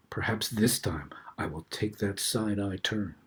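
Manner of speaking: soft secretive whisper. A narrator's reading. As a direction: breathe it like a secret. Secretive aside in narration.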